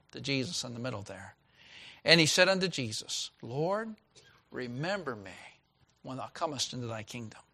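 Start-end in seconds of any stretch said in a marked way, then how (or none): 2.0-7.4 hushed, reverent reading of the thief's plea; scripture reading. Acted. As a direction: let the plea drop soft and humble. Pastor reads scripture aloud.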